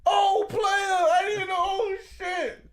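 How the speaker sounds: loud, high-pitched cries of amazement; astonished realization. Real, not acted. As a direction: blown away, voice shooting way up. Amazed at learning what the word means.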